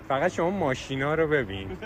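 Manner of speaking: ordinary talk, nothing marked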